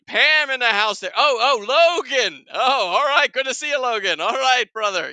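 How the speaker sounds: high, loud, thrilled welcome; excited greeting. Real, not acted. Host spots Pam and Logan in chat, excited. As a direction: hail friends as they walk in.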